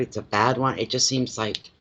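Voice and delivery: normal talking voice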